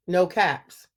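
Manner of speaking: usual speaking voice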